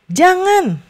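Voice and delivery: jangan said high and forceful, falling hard; firm warning jangan; emphatic prohibition. Shown as a demonstration. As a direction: stress the word jangan sharply as a warning. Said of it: "biasanya lebih ada penekanan".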